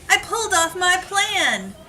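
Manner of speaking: plain reading voice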